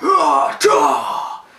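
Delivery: two loud, high attacking yells; effort shouts. Retold or imitated. Alberto swinging the chair, loud effort yells. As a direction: yell with each swing of the chair. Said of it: "he's like".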